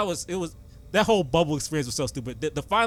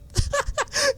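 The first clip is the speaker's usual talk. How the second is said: squealing laugh. Real, not acted. Laughing over Lou Dort pain.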